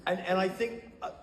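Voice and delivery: ordinary talk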